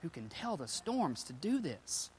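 awed stage whisper; very soft, astonished half-whisper; freaked-out hush. Acted. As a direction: whisper it in acted disbelief. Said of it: "That freaks them even more".

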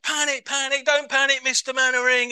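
high, loud comic flapping; mock panic. Disciples in the storm, high loud comic panic. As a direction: flap like Corporal Jones. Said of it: "They're in, like".

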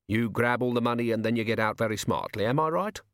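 plain reading voice

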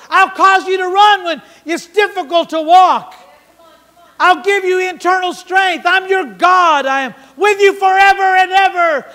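high, loud, building to a shout; God crying out. Retold or imitated. God speaks to his struggling church, crying out loud and high. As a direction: call to the church like a loving father. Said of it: "And he's crying out".

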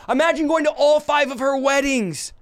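impassioned preaching. Acted. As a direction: preach it with rising fire. Preaching at full force.

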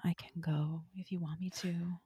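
hushed whisper to her. Retold or imitated. Daedalus whispering to Cersei in the hallway. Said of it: "And he's like".

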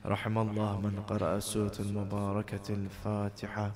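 low, soft, quickly recited Arabic prayer; closing prayer recitation. Real, not acted. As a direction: recite it hushed after the lament.

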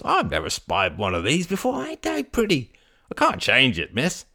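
marvelling, pitch swooping high; old fruit vendor, awestruck. Acted. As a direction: marvel at it in the trader's voice.

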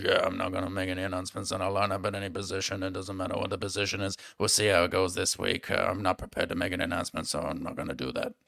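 soft, fast bedroom-voice coach; pillow talk voice. Retold or imitated. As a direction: hush it like pillow talk, quickly. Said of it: "Why are you using your pillow talk voice".

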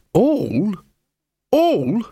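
rising outraged yelps; affronted little dog; indignant disbelief. Acted. Gaspode outraged at "is that all.

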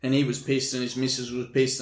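ordinary talk

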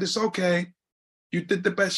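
high, gentle comfort; reassuring mother. Retold or imitated. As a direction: soothe your son. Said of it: "She said".

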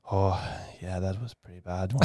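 understated, soft imitation of a grave doctor; low-toned Irish surgeon. Man tells patient after surgery, understated low-toned imitation. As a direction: lightly imitate a low-voiced bearer of bad news. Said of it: "had a really low tone of voice".

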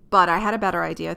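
normal talking voice

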